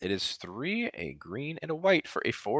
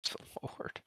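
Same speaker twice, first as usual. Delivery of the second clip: whisper; 17 dB quieter than usual. Real, not acted. Echoing a lord joke.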